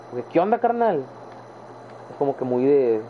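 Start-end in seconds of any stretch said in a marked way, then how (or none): 0.3-1.0 put-on higher-pitched rural norteño accent; northern Mexican accent. Showing how they talk in provincia, norteño accent. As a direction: put on a ranch-style northern Mexican twang. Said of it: "mucho más norteño".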